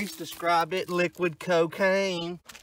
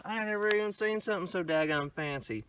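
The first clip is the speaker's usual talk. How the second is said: ordinary delivery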